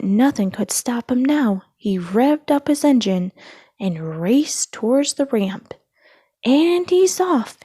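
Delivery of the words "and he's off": high, loud, slow stadium call; announcer calling the start; announcer yell. A narrator's reading. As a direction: shout the start to the crowd. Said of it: "yelled the announcer".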